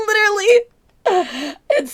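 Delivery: laughing crying. Real, not acted. Laughing at meme.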